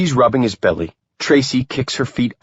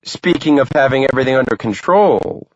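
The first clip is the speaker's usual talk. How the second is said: teasing; 6 semitones higher than usual. A narrator's reading. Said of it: "Tracy says to Max".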